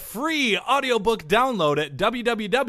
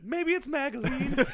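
laughing jingle; 12 semitones higher than usual. Pretend.

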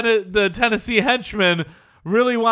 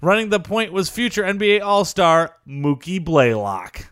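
documentary narration; 6 semitones lower than usual. Acted. Reading list narration.

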